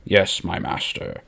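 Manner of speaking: slow whispered reply to master; hushed submission. Acted. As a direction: murmur it, head bowed. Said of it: "kneeling in order to try and deceive".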